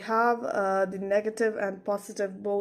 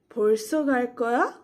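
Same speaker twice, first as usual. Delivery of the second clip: 벌써 갈 거야 louder, rising at the end; question intonation; 5 dB louder, much faster than usual. Shown as a demonstration. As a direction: ask 벌써 갈 거야 with a clear final lift. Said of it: "read as questions".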